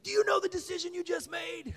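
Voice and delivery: high, very loud youth-preacher yell; fired-up shouting. Retold or imitated. Fired-up counselor to a boy who just decided, shouting. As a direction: shout it, bursting with excitement. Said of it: "he was all fired up".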